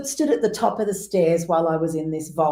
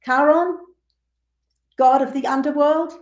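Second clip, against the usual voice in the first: ceremonious offering; 7 semitones higher than usual. Retold or imitated. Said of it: "I'm like".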